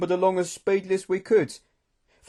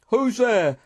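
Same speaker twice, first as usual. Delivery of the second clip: loud, raised-pitch call; menacing challenge; 7 semitones higher, 5 dB louder than usual. A narrator's reading. Narrator challenging the shadowy figure, menacing call. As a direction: challenge the unseen stranger. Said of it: "I called in my most menacing voice".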